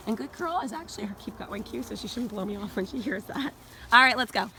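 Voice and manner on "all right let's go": loud, cheery round-up; calling the dogs. Real, not acted. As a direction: gather the dogs happily.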